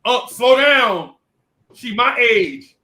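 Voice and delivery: shouted slowly, drawn out; slow him down with a loud drawn-out plea; loud slow protest. Retold or imitated. Boy shouting at his uncle to slow down, drawn out. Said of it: "So I was like".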